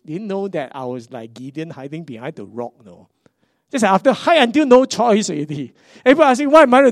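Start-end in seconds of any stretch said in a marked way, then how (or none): 3.7-6.9 excited outburst. Real, not acted. Everyone asking what to do.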